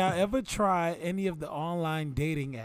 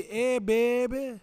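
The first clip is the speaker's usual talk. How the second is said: put-on slow, higher New Orleans hey baby; New Orleans accent; 5 semitones higher, slower than usual. Cohost shows how they say baby down there. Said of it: "the way they say baby".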